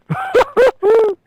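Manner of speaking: high, loud goofy laugh in character; Sloth laugh. Retold or imitated. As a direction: cackle like Sloth.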